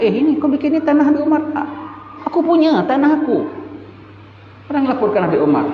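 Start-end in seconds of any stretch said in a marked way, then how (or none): 0.0-3.5 a trespasser insisting it's his land; mock quarrel. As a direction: act out a squabble over the land. Land dispute quarrel retold.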